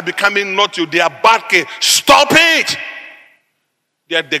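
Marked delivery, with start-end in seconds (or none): 1.8-2.8 high-pitched mock yell; sharp parental telling-off; acted scolding. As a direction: cut them off with a high, forceful command. Acting out scolding of children.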